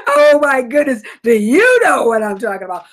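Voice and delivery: laughing outburst. Real, not acted. Host cracking up to listeners, loud laughing. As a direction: burst out laughing at the memory.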